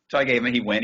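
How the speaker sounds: ordinary talk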